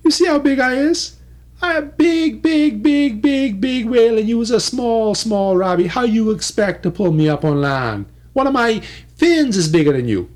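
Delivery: high, put-on voice of a bragging whale, easing down toward normal; boastful big whale. Whale boasting to small Robbie, high bragging voice. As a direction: crow about your size like a huge whale.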